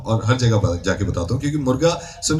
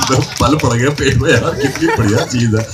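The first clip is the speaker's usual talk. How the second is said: laughing while talking. Retold or imitated. Victim laughing at the prank.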